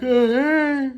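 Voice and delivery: high, loud "oh hey"; loud girly greeting. Retold or imitated. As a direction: squeal it out brightly. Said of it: "you're like".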